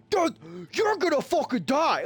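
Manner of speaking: high-pitched mock panic, overplayed; over-the-top imitation of the brother; exaggerated panicky brother. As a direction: ham up the frantic brother. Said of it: "not, okay, not that extreme".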